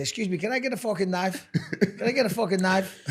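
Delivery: lightly mimicked kid's English accent with fucking said as "forking"; mild impression of a kid's accent where "fucking" comes out "forking"; imitating his son's accent. Dad imitating his young son ordering in a restaurant, light accent. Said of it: "and with his accent".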